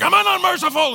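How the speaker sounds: high, loud imperial outburst; shouting emperor. Acted. Emperor insisting on his own mercy, shouted high and loud. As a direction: bellow it like an affronted emperor. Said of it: "he was the emperor".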